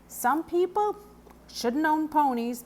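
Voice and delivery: put-on stern old horseman; lightly voiced gruff groom; gruff farrier voice. A narrator's reading. Grimes voiced.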